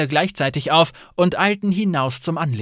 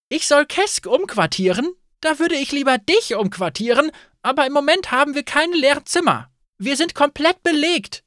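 higher, snappy innkeeper voice, louder; innkeeper Fayette, irritated; 12 semitones higher, 4 dB louder than usual. Acted. Innkeeper refusing a guest, irritated and high. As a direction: fire back at the pushy guest.

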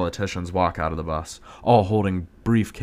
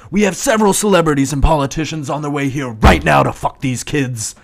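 higher, louder panicked explaining; frantic yell; 7 semitones higher, 8 dB louder than usual. Acted. Man yelling to partner about arriving guests, frantic. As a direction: rant it out in a panic.

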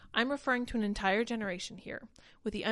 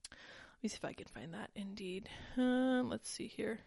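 whisper; 8 dB quieter than usual. Real, not acted. Muttering to self while searching.

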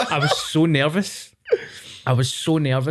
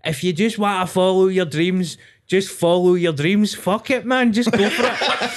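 motivational speech; 6 semitones higher than usual. Retold or imitated. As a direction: hype up a crowd of kids. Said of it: "this weird motivational speech".

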